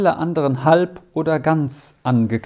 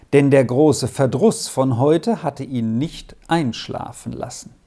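ordinary delivery, nothing marked

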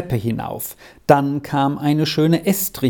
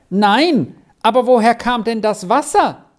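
high, raised-voice question; calling back; 8 semitones higher, 3 dB louder than usual. A narrator's reading. Arthur answers from the corner, calling back. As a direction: call back from the far corner. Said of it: "tönte die Antwort aus der Ecke".

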